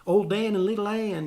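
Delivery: a hint of a Southern boy accent. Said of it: "I was just doing sort of a Southern boy".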